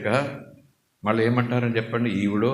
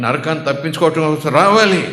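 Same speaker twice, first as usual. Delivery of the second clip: much louder, rising to a forceful peak; preaching climax; 4 semitones higher, 9 dB louder than usual. Real, not acted. Preacher telling believers why to come, loud climax. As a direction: drive the point home at full force.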